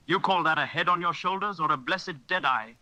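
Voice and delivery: high, scornful outburst; cried out. Acted. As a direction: berate him like a fool. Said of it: "cried Long John".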